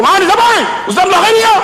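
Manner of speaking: high-pitched phone interrogation; brusque principal. Retold or imitated. Principal answering the phone, brusque high voice. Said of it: "Dia cakap memang macam tu".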